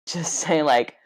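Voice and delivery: usual speaking voice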